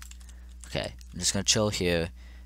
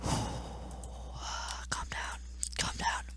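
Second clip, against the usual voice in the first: very soft, whispered reassurance; self-soothing whisper; 11 dB quieter, much slower than usual. Real, not acted. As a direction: whisper to settle yourself down. Soothing self after raging.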